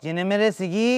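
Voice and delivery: loud, drawn-out village dialect; hammed-up rural Persian dialect greeting. (لهجهٔ غلیظ روستایی، کشیده)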